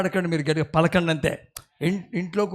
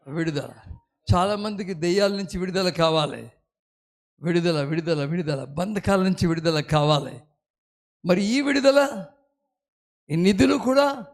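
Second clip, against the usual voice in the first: ordinary talk, nothing marked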